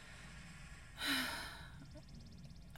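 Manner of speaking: high, airy drawn-out sigh of pleasure; soft breathy moan. Acted. Aroused moan mid-scene.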